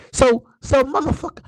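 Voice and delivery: high, loud disbelieving challenge; outraged retort. Acted. Acted outraged retort to leader.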